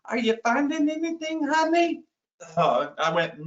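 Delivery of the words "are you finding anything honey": high, sweet and sugary wife; sugar-sweet mock innocence to her husband. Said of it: "Very sweetly from across the room".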